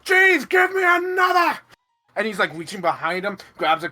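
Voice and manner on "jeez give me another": high, loud triumphant shout; excited acted battle holler; gloating Lord Mayor. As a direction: crow over a hit and yell for more. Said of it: "you can hear the Lord Mayor goes".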